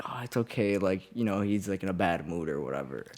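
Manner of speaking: soft, hushed soothing grandma; calm him down gently. Retold or imitated. Grandma soothing the boyfriend after yelling, soft. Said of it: "her grandma was really soothing".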